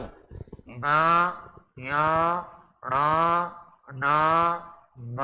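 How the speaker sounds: ङ, ञ, ण, न, म each said through the nose; nasal letters. Shown as a demonstration. Teacher demonstrating nasal letters to students, through the nose. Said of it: "नाक से बोलते हैं पांचों".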